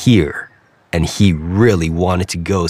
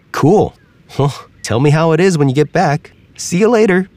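higher, breezy frog farewell; casual frog waving him off; 7 semitones higher than usual. Acted. Frog says goodbye.

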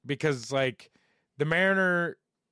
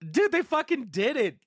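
excited; 10 semitones higher than usual. Real, not acted. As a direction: burst out with excitement. Excited about the Casa Bonita sale.

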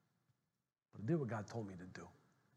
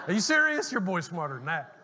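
much higher and much louder, laughing; laughing disbelief; 7 semitones higher, 16 dB louder than usual. Real, not acted. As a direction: cry it out laughing in mock disbelief. Mock outrage after joke.

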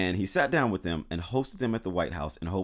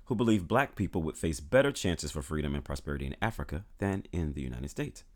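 ordinary talk

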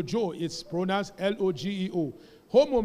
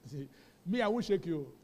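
ordinary delivery

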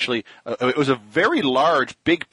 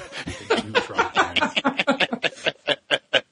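high, long laugh fading out; high laughing fit; 11 semitones higher, 3 dB quieter than usual. Real, not acted. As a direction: break up laughing after the joke. Cracking up after the bit.

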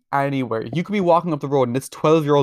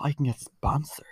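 hushed secret aside; a conspiratorial whispered aside; 6 dB quieter than usual. Real, not acted. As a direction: lean in and whisper it. Secretive aside about sponsorship.

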